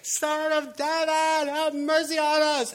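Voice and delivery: high, loud, drawn-out scream for mercy; screaming beggar. Acted. Blind beggar calls out to Jesus, acted high scream. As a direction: act out a desperate yell to be heard over the crowd. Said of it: "And he started screaming".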